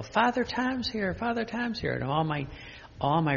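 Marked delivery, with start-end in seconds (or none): 0.0-1.9 high, sing-song grandma call; grandmother's greeting. Retold or imitated. Grandmother announcing the priest's arrival, high and sing-song. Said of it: "My grandmother would go".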